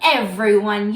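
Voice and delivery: normal talking voice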